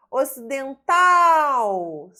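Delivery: "Ocidental" with a long, loud final TAL; stressed syllable demonstration. Shown as a demonstration. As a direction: punch and hold TAL in "Ocidental". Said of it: "oxítona".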